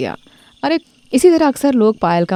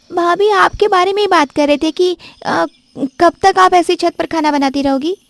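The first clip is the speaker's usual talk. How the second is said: put-on much higher girlish voice, needling with a rising question; prying sister-in-law; 11 semitones higher than usual. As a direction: cover the gossip with a sweet prying question. (बहुत ऊँची आवाज़ में भाभी से चुभता सवाल करती ननद)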